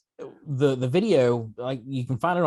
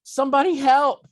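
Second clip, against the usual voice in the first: high, loud call for help; desperate cry for help; 13 semitones higher, 7 dB louder than usual. Retold or imitated. Cub calling for help over his dad. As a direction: cry out for someone to come.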